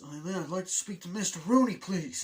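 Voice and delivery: high, loud fake caller voice; hammed-up, high-pitched disguised voice; put-on phone voice. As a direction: impersonate a caller asking for the principal. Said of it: "I love the accent that Alan Ruck puts on".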